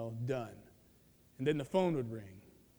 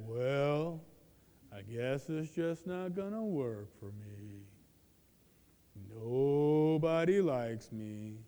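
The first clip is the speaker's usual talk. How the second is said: low, slow, droopy self-pity moan; mopey Eeyore voice; 6 semitones lower, 4 dB quieter, slower than usual. Shown as a demonstration. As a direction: whine it like gloomy Eeyore. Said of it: "if we said it in that voice".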